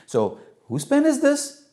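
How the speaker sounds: high, leading motherly question; mother quizzing child. Acted. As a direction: quiz a small child, swooping up high.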